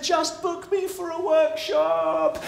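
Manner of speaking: high, drawn-out far-off call; calling after him. Acted. Storyteller shouts after the man running away, calling out. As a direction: stretch every word out, high, to carry.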